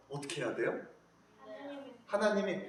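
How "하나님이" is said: ordinary talk, nothing marked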